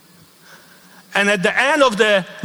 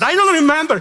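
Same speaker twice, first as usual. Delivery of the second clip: arrogant reply; 8 semitones higher, faster than usual. Retold or imitated. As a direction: toss it off with youthful arrogance. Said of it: "I was so arrogant".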